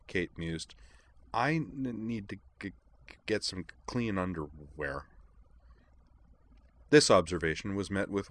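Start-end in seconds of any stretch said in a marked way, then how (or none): 1.3-5.1 halting, softening stutter; stammering. A narrator's reading. Kate muses on what she needs, stammering. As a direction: stumble on the first consonants.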